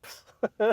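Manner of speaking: loud scoffing puff of air; sharp mock-dismissive hiss; dismissive "psst". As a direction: imitate a girl brushing someone off. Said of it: "And the girl's like".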